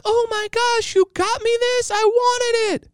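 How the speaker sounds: hammed-up high squeal of delight; happy wife. Wife thanks husband for the hoodie, mock-squealing with joy. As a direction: imitate a thrilled wife in a very high falsetto. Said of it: "she was so happy".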